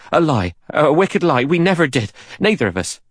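desperate hoarse denial. A narrator's reading. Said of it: "Rogers said hoarsely".

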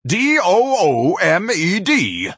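"D O O M E D" spelled letter by letter, slow, high and loud. Acted. Ignatius yelling after the girls, slowly spelling out doomed. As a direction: spell it out as a shouted curse.

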